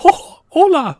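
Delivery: very high, loud cartoon falsetto; high-pitched mouse voice; Mickey Mouse. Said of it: "speak Spanish as Mickey Mouse".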